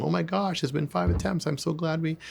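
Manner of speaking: high, bubbly praise; gushing reward. Shown as a demonstration. Sales coach gushing at a prospect, bubbly. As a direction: gush over them for picking up. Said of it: "I can escalate them with my tone of voice".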